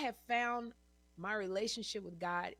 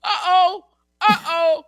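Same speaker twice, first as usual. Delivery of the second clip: much higher, much louder alarmed uh-oh; 10 semitones higher, 21 dB louder, slower than usual. Retold or imitated. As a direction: sound the alarm, half laughing. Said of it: "And I was like".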